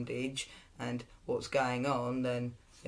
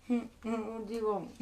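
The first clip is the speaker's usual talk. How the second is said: mock daughter voice; 8 semitones higher, slower than usual. Daughter voiced in teasing scene.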